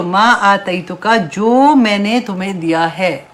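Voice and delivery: slow, weighty verse and gloss; slow reading of the verse; slow, deliberate reading. Acted. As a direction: lay each word down slowly. Verse and translation said slowly.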